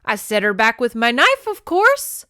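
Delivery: loud, climbing high at the end; insist, pitch soaring on the last words; defensive. A narrator's reading. Ross justifying himself to the clan, defensive. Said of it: "He glanced around the circle defensively".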